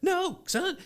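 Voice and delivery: high, rushed pleading; frantic dad. Retold or imitated. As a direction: beg the kid to stop kicking. Father pleading with kicking son.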